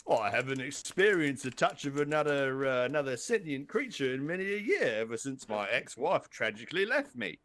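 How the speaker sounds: plaintive mock English accent, melodramatic; English crocodile jailer. Lonely crocodile jailer offered a hug, plaintive put-on English accent. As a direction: lament it as a comic English guard. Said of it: "I don't know why he's English now".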